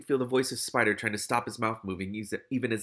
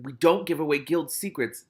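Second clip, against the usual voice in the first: higher, louder scolding; snapped; 4 semitones higher, 5 dB louder than usual. A narrator's reading. Ratcatcher One rebuking his partner over secrets, snapping. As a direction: mildly snap a reprimand. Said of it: "Ratcatcher One snapped at him".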